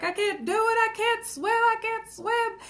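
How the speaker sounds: put-on high, panicky child's wail; scared little boy. Retelling a video of a boy in the pool, high panicked child voice. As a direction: imitate a frightened boy who thinks he'll drown. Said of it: "he was just freaking out".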